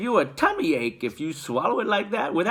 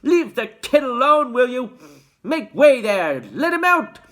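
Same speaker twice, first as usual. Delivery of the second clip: loud commands; 11 semitones higher, 8 dB louder than usual. A narrator's reading. Shopkeeper clearing the crowd.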